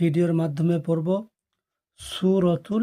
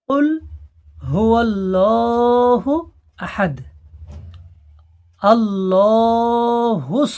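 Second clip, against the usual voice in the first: louder slow chanted verses; loud recitation; 4 semitones higher, 5 dB louder, much slower than usual. Shown as a demonstration. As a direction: recite loudly and drawn out. (জোরে টেনে তিলাওয়াত)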